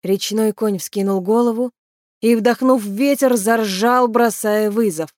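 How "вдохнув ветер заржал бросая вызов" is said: usual speaking voice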